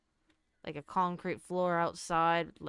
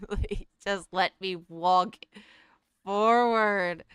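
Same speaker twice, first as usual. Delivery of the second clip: frustrated loud plea; 11 dB louder, slower than usual. Real, not acted. Frustrated with game controls.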